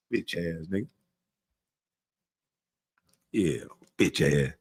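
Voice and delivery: quiet scornful mutter after hanging up; muttered insult. Real, not acted. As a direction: drop it under your breath. Mutters insult after mock call.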